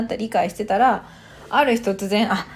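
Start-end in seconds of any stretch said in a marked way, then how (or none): 2.3-2.4 sudden realisation. Retold or imitated. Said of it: "アハ体験がありましたね".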